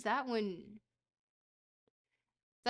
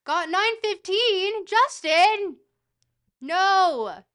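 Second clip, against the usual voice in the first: high, stretched yell of disbelief; outraged shout; 9 semitones higher, 15 dB louder, much slower than usual. Real, not acted. Streamer learns Justin got nine fifteen, outraged shout. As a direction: shout it high and very loud, aghast.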